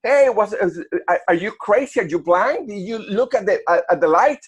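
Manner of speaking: retold outburst in English with a Venezuelan accent. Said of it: "le digo a la mujer con mi acento".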